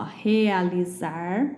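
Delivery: "realizar" slowly, syllable by syllable; Z sound. Shown as a demonstration. Teacher explaining group C, clear Z in "realizar". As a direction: stretch "realizar" to show the buzzing Z. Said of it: "é o Z".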